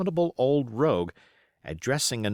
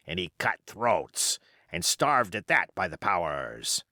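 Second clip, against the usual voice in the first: put-on old pirate. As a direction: spin yarns like a scheming old pirate. Silver's pirate voice.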